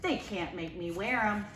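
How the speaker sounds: plain reading voice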